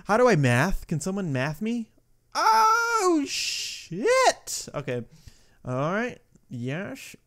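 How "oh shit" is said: drawn-out falsetto cry of dismay; high-pitched dismay. Real, not acted. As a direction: let it shoot way up high. Failing the level.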